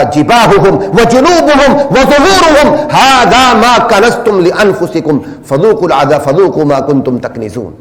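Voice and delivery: high, slow chanted Quran verse; slow recitation. Real, not acted. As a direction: chant the warning slowly. (دھیمی اور اونچی قرآنی تلاوت)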